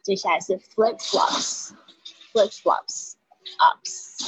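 "flip flops" said slowly, with the ending "ups" isolated; slow sound-by-sound drill. Shown as a demonstration. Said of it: "注意一下flops".

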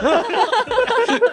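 squealing falsetto laugh; high giggle. Real, not acted. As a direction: burst into a shrill giggle. Falsetto laugh after quote.

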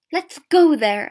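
loud, high, rousing call; crying out. A narrator's reading. Old woman rouses the drinkers, crying out. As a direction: cry it out to the room. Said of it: "an old woman cried".

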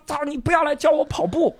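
high, sharp mock outburst; angry retort. Runner snapping at the writer who gave advice, mock angry. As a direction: snap back at the unwanted advice.

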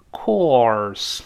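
"course" drawn out, tongue curling into the r; curled-tongue r. Shown as a demonstration. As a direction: stretch "course" slowly through its r. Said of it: "再卷舌，course". (“course”拉长并卷舌)

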